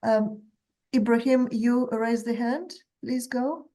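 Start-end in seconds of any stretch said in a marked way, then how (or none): none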